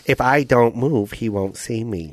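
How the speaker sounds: evil Vietnamese raccoon, slowed down. Acted.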